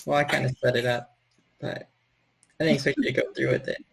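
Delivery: normal talking voice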